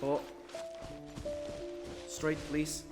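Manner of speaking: usual speaking voice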